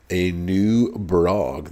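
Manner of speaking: a new Brog, slow, stretching out "new"; drawn-out, teasing reveal; drawn-out hype. Real, not acted. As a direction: savor "new" like an announcement. Hyping the new boss.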